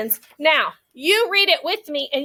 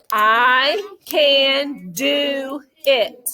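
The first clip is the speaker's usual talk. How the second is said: very slow, drawn-out words; much slower than usual. Shown as a demonstration. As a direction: lead the class one word at a time. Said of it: "let's try it one more time".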